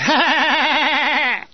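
high forced Cockney laugh of embarrassment; embarrassed put-on Cockney cackle. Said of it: "forced Cockney laugh of embarrassment".

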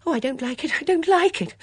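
high, rising plaintive wail; frightened protest. Acted. Frightened woman in pandemonium.

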